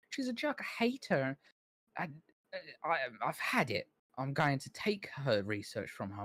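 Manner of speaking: a put-upon assistant venting about his boss; high, whining grudge-filled voice; Calmont, whiny and resentful. Acted.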